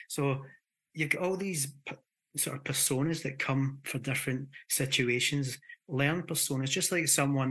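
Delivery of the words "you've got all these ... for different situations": ordinary talk, nothing marked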